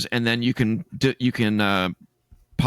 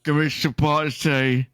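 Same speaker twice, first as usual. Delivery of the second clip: moaning undead Brit, hammed up; a put-on British zombie groan; 4 semitones higher, 3 dB louder than usual. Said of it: "my impression of a British zombie".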